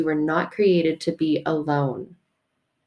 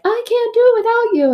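high, pleading little voice; whiny plea; 4 dB louder than usual. Acted. Boxer to his coach, a high whiny plea. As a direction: beg your coach not to leave.

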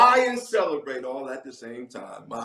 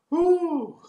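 high shouted exhale; exultant whew; joyful whew; 7 dB louder than usual. Real, not acted. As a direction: cry out in praise. Preacher's exclamation.

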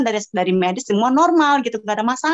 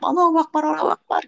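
retold panicky invocation, higher pitch; seruan panik tiruan; 6 semitones higher than usual. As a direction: mimic calling on God in alarm. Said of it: "Aku panik sebalik-baliknya". (seruan panik bernada lebih tinggi)

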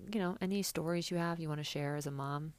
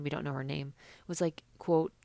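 ordinary delivery

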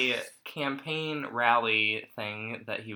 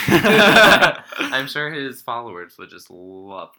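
loud laughing sarcasm; 12 dB louder, much slower than usual. Real, not acted.

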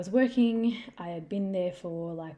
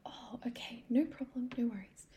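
soft, higher-pitched, breath-light reassurance; kindly; 6 semitones higher, 3 dB quieter than usual. Retold or imitated. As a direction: imitate a kind mum letting it go gently. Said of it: "looked at me really kindly".

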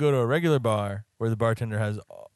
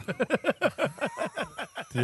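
laughing. Real, not acted. Laughing while asking.

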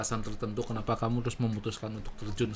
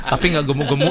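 laughing; 11 dB louder than usual. Real, not acted. Laughing while joking.

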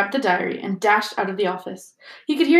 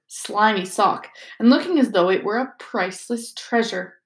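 ordinary delivery

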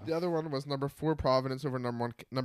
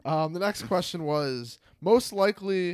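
louder, higher, perked-up energy; enthusiastic; 5 semitones higher, 7 dB louder than usual. Real, not acted. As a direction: put some pep into it. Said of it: "There we go".

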